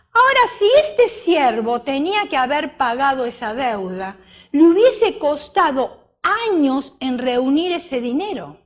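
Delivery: normal talking voice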